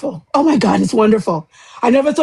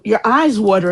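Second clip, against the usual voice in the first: usual speaking voice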